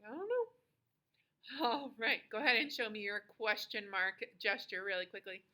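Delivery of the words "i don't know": high, soft "dunno"; quiet, high-pitched cluelessness; shrugging uncertainty. Shown as a demonstration. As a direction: lift the voice lightly as if shrugging. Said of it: "I shrug my shoulders".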